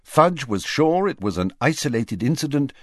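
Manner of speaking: ordinary delivery, nothing marked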